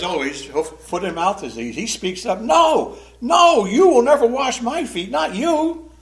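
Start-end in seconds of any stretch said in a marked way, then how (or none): 2.4-5.9 much higher and louder, horrified no; shocked refusal. Acted. Peter shocked at Jesus at his feet, loud high refusal. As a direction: recoil at the very idea. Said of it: "He's shocked".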